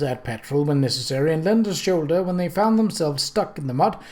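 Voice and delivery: usual speaking voice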